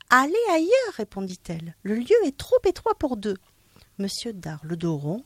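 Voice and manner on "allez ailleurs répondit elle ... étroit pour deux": high, curt refusal. Acted. Madame Vazedoux refusing Monsieur Dard, high curt voice. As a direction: shoo him away.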